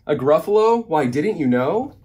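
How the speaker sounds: storybook mouse showing off what it knows; knowing surprise. A narrator's reading. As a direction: exclaim it high, amazed they haven't heard. Mouse answers the puzzled snake.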